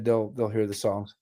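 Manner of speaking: usual speaking voice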